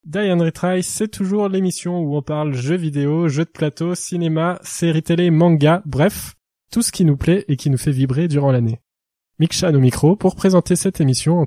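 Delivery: loud, upbeat show opening; podcast host intro. Acted. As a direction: announce the show with energy.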